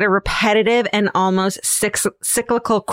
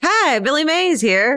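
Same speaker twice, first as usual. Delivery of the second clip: higher, slow, booming announcer; infomercial pitchman; 7 semitones higher than usual. Retold or imitated. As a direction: sell it like a TV pitchman. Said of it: "It's just a little joke on it".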